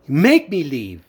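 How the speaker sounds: imitating a sister's defiant dare; retold defiant challenge. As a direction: throw down a challenge, pitch leaping then falling.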